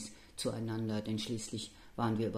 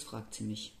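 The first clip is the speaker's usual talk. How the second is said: ordinary delivery, nothing marked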